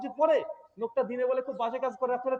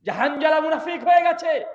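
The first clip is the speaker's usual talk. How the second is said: loud, higher anguished shout; shouting in the street; 6 semitones higher, 7 dB louder than usual. Retold or imitated. Hanzala crying out in Medina's streets, loud anguished shout. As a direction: shout your despair down the street. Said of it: "চিৎকার মারছে".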